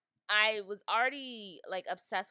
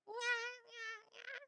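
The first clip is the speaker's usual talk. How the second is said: tiny high-pitched giggle; squeaky giggle; 6 dB quieter than usual. Shown as a demonstration. Podcaster showing off voice changes, soft squeaky giggle. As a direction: giggle softly in a squeaky little voice.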